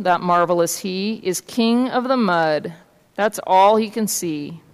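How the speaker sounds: measured picture-book reading; story narration. A narrator's reading. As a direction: read the tale aloud to children. Reads a story aloud.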